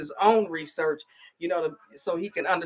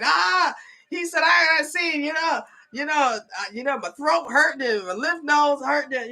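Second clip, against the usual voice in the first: imitating whiny son; 8 semitones higher than usual. Son's complaint imitated.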